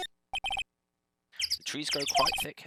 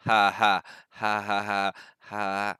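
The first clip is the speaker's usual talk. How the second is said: slow laugh; 3 semitones lower, much slower than usual. Acted. Lotta laughs at her name.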